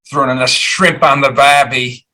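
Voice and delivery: hammed-up Aussie accent; mock Australian twang, badly faked. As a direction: put on a terrible Australian accent. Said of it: "Sorry about my terrible accent".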